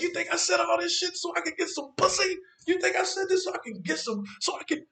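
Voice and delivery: very high, loud outraged yell; incredulous, furious outburst, voice shooting up; livid. Retold or imitated. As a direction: hurl it back in high-pitched outrage. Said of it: "I get even madder".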